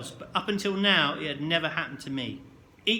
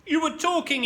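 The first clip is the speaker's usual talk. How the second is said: high loud barked accusation; teacher barking at a boy; 12 semitones higher, 5 dB louder than usual. A narrator's reading. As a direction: snap it like a drill sergeant. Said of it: "he barked".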